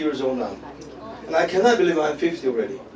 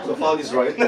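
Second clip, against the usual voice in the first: ordinary talk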